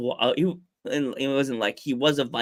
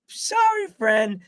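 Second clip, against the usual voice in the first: high apologetic; much slower than usual. Real, not acted. Apology to friend in chat.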